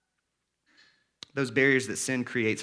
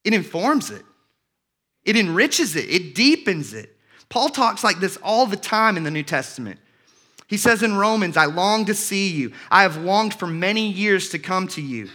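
higher and louder, pressing each quoted line; fervent preaching; 4 semitones higher, 4 dB louder than usual. Acted. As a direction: let every verse ring out with longing. Reads Paul's letters with fervour.

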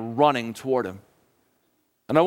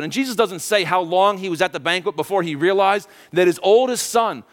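ordinary delivery

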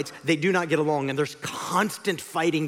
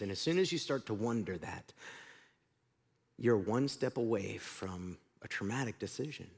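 softer, low-key confessional sermon tone; quiet preaching; 7 semitones lower, 7 dB quieter than usual. Acted. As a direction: draw the congregation in gently. Soft reflective preaching on temptation.

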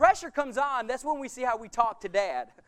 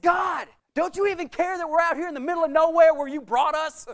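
loud, higher accusing protest; angry at God; 5 semitones higher, 6 dB louder than usual. Retold or imitated. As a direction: demand an answer in anger. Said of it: "and I was mad".

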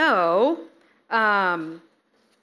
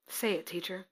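much softer, humble answer; bow your head and say it softly; humble, hushed reply; 2 semitones lower, 11 dB quieter than usual. Acted. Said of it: "look at how Simon responds".

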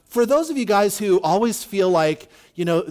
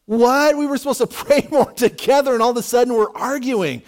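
higher, louder mock indignation; exasperated outburst; 5 semitones higher, 3 dB louder than usual. Spouse in the car mid-argument, exasperated. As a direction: act out a spouse's disbelieving complaint. Acted married couple's blow-up argument.

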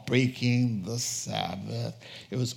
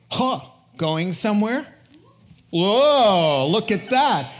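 mock surprise; 5 semitones higher, 6 dB louder than usual. Pharisees mockingly catch disciples.

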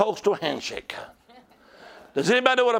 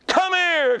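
much higher, stretched summons that slides down; calling out; 8 semitones higher, 3 dB louder than usual. Acted. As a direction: call them over from across a crowd. Voices the Lord calling someone.

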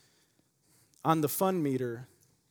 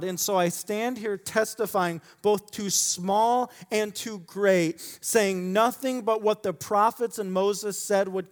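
measured, earnest reading of scripture; 4 semitones higher, 4 dB louder than usual. Acted. Pastor reading Acts aloud to the congregation, steady and earnest. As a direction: lay the passage out steadily for the church.

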